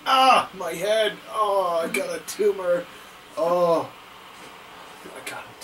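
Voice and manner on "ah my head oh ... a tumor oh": loud, higher groaning in pretend pain; playful, drawn-out cry of pain; mock agony. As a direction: moan it out like a hammed-up head injury. Acting hurt for game character.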